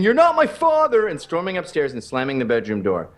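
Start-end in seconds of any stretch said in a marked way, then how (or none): 0.0-1.1 put-on higher, louder teen yell; mock scream. A mock yell for the stepkid storming upstairs. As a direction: shriek it like a sulking kid. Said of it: "you keep screaming".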